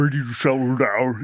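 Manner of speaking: muffled voice from inside a helmet; muffled Darth Vader. Acted. Costumed paramedic calms an accident victim, muffled masked voice. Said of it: "like, muffled voice".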